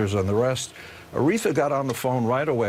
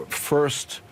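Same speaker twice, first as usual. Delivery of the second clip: usual speaking voice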